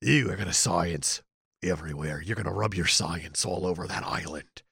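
put-on low, lightly done English accent, Russell Crowe style; a hint of a British captain, low and lazy. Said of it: "as good of an English accent as Russell Crowe can do".

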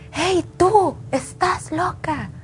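imitating a yell. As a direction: call out high and accusing, half-acted. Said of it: "empezó a gritar". (grito imitado, agudo)

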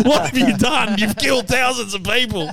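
high-pitched appalled cop, cracking up; hammed-up high accusation, laughing; laughing mock outrage. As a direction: cry out in pretend horror through laughter. Acts out cop confronting action hero.

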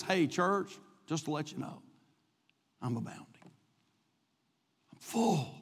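low, very soft voice, spaced out with long pauses; hushed confiding. Acted. Paul writes to his church from jail, hushed and confiding. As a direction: let it sink almost to a murmur.

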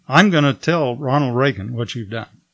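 usual speaking voice

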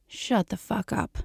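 soft, slow smug taunt; quiet, slow and self-satisfied. A narrator's reading. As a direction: savor each word, smug and unhurried. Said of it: "he says smugly".

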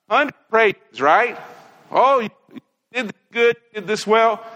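usual speaking voice

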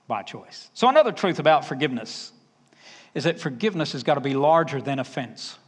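usual speaking voice

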